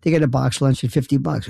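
usual speaking voice

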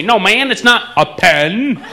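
slow, loud, high mock-eastern "pen" from a Texan; Texan imitating Easterner. Austin guy mocking the comedian, Texan doing eastern accent. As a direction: mimic the visitor's "pen" with exaggerated care. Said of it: "He tries to imitate me".

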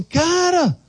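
high, fast, eager friend talking him up; an eager friend hypes him up to be a pastor, high and fast; excited buddy. Retold or imitated. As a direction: gush like a pushy friend. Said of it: "um amigão dele chegou e falou assim".

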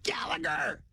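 high, strained, held-back shout; mock coach hollering, drawn out; distant coach yell. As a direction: call across a field without real volume. Coach shouting from forty yards away.

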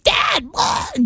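put-on high kid calling dad; lightly voiced child. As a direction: mimic the child again. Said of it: "the kid's like".

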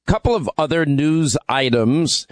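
usual speaking voice

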